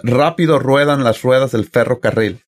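"Rápido ruedan las ruedas del ferrocarril" with every rr trilled; Spanish tongue twister. Shown as a demonstration. Host showing guests the Mexican tongue twister for rolled R. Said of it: "the ultimate Mexican tongue twister for the R's".

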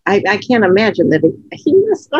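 ordinary talk, nothing marked

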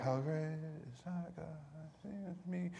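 "How great is our God" muttered softly without conviction; half-hearted mumble. Shown as a demonstration. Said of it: "we start 'em like".

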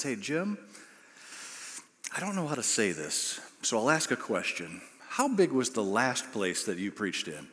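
soft, low, careful lead-in; awkward aside. Retold or imitated. Camera operator pulls new preacher aside, awkward and soft. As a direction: break it to him gently, choosing words.

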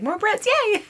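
high-pitched mock-excited cheer; playful cheer. Traveler delighted to meet a British guy, playful high cheer. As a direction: squeal it as a playful little yay. Acting out delight at meeting Brit.

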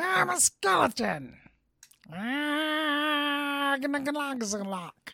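slow, high, hammed-up skeleton. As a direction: croak it out like a spooky skeleton. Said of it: "can you do my skeleton voice".

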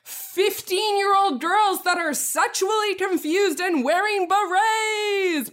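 car-show host. Acted. Car-show host revealing a film bro's ride, rising hype. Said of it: "a film school bro who goes on Pimp My Ride".

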